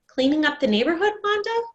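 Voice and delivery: a friendly neighbor passing by; cheery neighbor. Acted.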